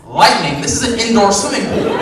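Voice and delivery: high, loud disbelieving squawk; outraged at the absurd excuse; shouting disbelief at the desk clerk; incredulous. Acted.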